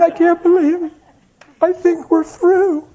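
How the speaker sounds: high, whiny mock God voice; a parody of a pouting God; mock sulky God. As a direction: pitch it way up like a sulking, hurt deity. Mocking God as sulking.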